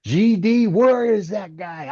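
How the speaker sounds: high-pitched mock yell, not at full volume; imitated scream, drawn out at the end; retold screaming. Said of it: "he come out screaming".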